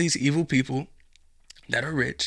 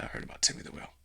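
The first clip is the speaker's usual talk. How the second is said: hushed awe; 10 semitones lower, 6 dB quieter, much faster than usual. Acted. Burglar softens, confides.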